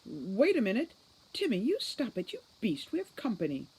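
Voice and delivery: high, loud flustered calls through a door; flustered scolding. Acted. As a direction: playfully shoo someone off while caught out. Mother scolds playfully behind door.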